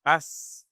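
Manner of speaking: "s" sliding into tha as the tongue connects. Shown as a demonstration. Teacher showing how the tongue makes tha. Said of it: "as soon as you connect it".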